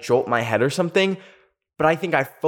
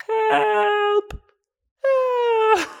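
very high, thin wailing help; pitiful high-pitched whimper for help; faint cries for help. Retold or imitated. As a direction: squeak it out like a helpless kid. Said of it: "faint cries for help".

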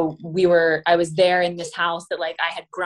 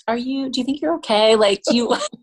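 higher, gently worried mother voice; worried mom; 6 semitones higher, 3 dB louder than usual. Retold or imitated. Imitating her mom checking on her after birth, lightly worried. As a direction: fret like a concerned mom. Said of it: "She's like".

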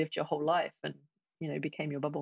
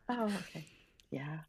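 ordinary talk

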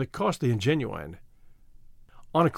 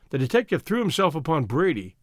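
ordinary delivery, nothing marked

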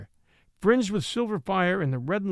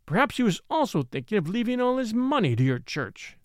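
higher-pitched, sneering insinuation; sneering, pitched up, leaning on "also"; blasting sneer; 5 semitones higher than usual. A narrator's reading. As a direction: throw it as a scornful accusation. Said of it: "with a blasting sneer".

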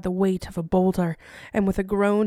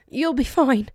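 higher, louder, rushed and panicky; frantic reassurance; 6 semitones higher, 6 dB louder than usual. A narrator's reading. Eleonora to her fevered husband, frantic and higher. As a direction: insist it desperately, losing grip. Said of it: "said quickly, sounding irrational".

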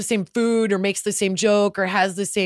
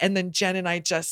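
ordinary delivery

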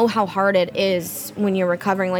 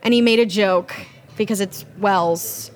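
usual speaking voice

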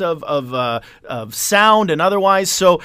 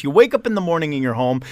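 usual speaking voice

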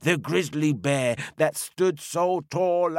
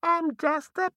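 very high, innocent little voice; round hollow pumpkin voice. Acted. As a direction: squeak it like a fibbing pumpkin. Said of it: "a voice said".

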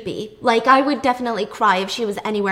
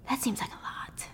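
whispered aside; 15 dB quieter than usual. Real, not acted. Aside while pouring milk.

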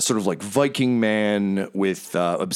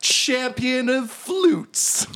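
vain bard; 12 semitones higher than usual. Acted. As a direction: brag about your pan flute. Bravestar boasts about flute.

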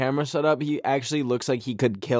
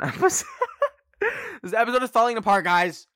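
laughing; 11 semitones higher, faster than usual. Real, not acted. Laughing at her messy episode.

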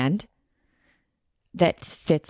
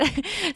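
giggle; 12 semitones higher than usual. Real, not acted. Laughs at her own quote.

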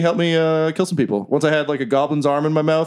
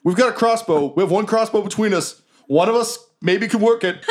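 a higher, pleading dwarf worker; 6 semitones higher than usual. Acted. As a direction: beg the intruders to leave you be. Dwarf warns them off.

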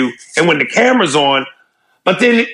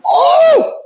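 high yelp. Acted. Sudden yelp.

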